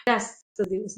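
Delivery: low, soft sigh of relief; spent, relieved murmur. Shown as a demonstration. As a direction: let it sink out, quiet and relieved. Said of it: "com alívio".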